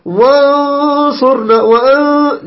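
وانصرنا with a very long, high, nose-squeezed ن; over-pressed nasal noon. Shown as a demonstration. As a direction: push the ن of وانصرنا hard into the nose. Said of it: "فلا تضغط على الخيشوم".

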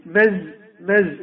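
मز- को साफ़ ज़ के साथ धीरे से बोलना; slow buzzing z start of مز-; wrong z sound. Shown as a demonstration. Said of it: "لا، هي مسجد".

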